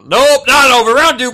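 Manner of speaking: loud, high mock announcement; hammed-up announcement. Telling a friend the sickness came back, a loud hammed-up announcement. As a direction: boom it out like a fight announcer calling the next round. Retelling a second bout of vomiting.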